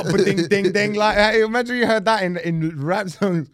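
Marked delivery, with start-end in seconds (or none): 0.0-0.9 gunshot ad-lib imitation.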